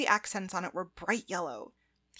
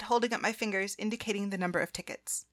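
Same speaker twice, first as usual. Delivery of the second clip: ordinary delivery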